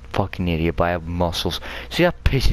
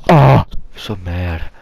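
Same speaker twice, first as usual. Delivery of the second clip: drawn-out angry groan; 7 dB louder, slower than usual. Real, not acted. Frustrated with the game.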